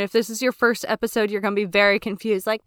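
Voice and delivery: usual speaking voice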